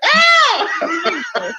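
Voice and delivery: embarrassed scream at the phone call; alarmed shriek. Retold or imitated. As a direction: yelp in panic. Panic at the boy's high voice.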